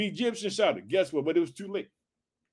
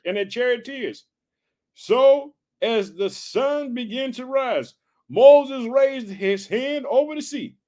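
measured, slightly raised reading voice; scripture reading; 4 semitones higher than usual. Acted. Pastor reading Exodus to the congregation, measured reading voice. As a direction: read the Bible passage out steadily.

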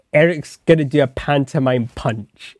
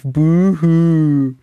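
mocking boo-hoo; 5 semitones higher, 5 dB louder than usual. Mock crying at dead wife.

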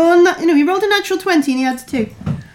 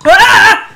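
retold scream; 7 semitones higher, 8 dB louder than usual. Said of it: "you've heard Topper go".

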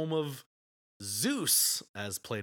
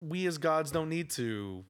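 high, blustery mock god, fast; lightly hammed carefree Zeus; a mild send-up of a smug god; Zeus, big and blustery; 5 semitones higher than usual. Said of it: "he feels like".

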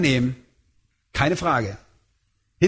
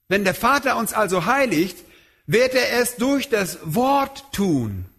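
ordinary delivery

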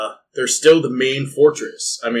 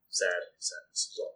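fading mock sorrow, dropping softer; mock sad; 6 semitones lower, 16 dB quieter than usual. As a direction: sigh it out, half-joking. Mock mourning for absent player.

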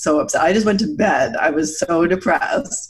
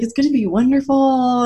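delighted dad; 7 semitones higher than usual. Retold or imitated. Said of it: "he's like".

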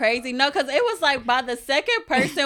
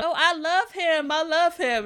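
higher, slow, dreamy infatuated voice; lovestruck gushing; 7 semitones higher, slower than usual. Retold or imitated. Friend imitates herself gushing over a new man. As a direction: gush over him like you're smitten. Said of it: "I am Keke Palmer".

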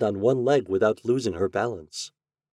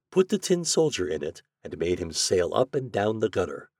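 ordinary delivery, nothing marked

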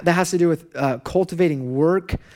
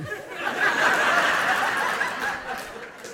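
squeaky breathless laugh rising up; high wheezing laugh; 4 dB quieter than usual. Real, not acted. Preacher telling the congregation about his son, squeaky helpless laugh.

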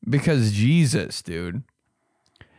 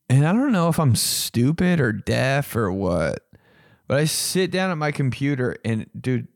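ordinary talk, nothing marked

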